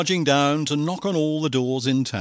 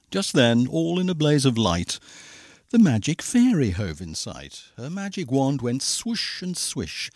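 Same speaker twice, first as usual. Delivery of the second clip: plain reading voice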